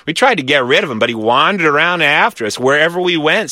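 usual speaking voice

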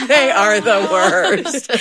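very slow, laughing, stressing they; laughing agreement. Retold or imitated. Wife agreeing with husband about the kids, laughing. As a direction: savor each word through laughter. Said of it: "And I'm like".

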